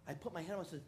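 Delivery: ordinary talk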